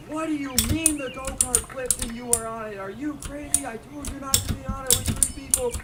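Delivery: furious mom scolding her son; angry mom. Retold or imitated.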